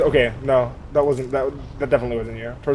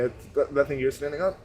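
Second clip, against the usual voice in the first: ordinary talk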